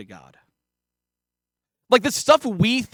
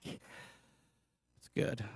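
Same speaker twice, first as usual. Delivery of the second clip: tossed-off, deadpan; blunt, offhand aside; flat throwaway delivery; 5 semitones lower, 17 dB quieter than usual. Real, not acted. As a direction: say it like a shrug, then drop it away. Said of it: "I don't know why I said it like that".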